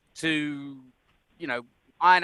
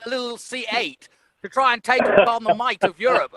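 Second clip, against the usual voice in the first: higher, louder plummy English commentator; patronizing English pundit, pitched up and louder; mock English commentator; 5 semitones higher, 5 dB louder than usual. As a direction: ham up a condescending British pundit. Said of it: "It's all written by English people".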